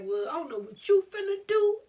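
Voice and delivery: high, loud mocking imitation; exaggerated not-my-problem voice; dismissive guys. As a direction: act out someone washing their hands of it. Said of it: "most of these guys would be like".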